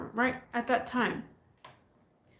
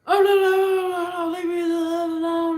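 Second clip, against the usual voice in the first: high, loud panicked no no no; mock frightened plea; panicked pleading; 5 semitones higher, 4 dB louder than usual. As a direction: beg to be left alone, voice rising. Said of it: "uh, and".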